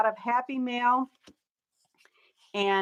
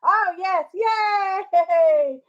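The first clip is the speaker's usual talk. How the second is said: higher and louder happy yay; excited cheer; 8 semitones higher, 7 dB louder, much slower than usual. Real, not acted. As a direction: cheer as viewers show up. Viewers finally answer.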